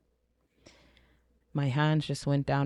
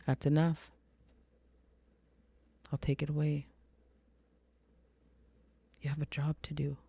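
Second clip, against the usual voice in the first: soft, restrained quoting of a divine voice; gently imitating a voice from above; beautiful divine voice; 7 dB quieter, faster than usual. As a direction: say it softly, with calm finality. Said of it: "And then I hear it again".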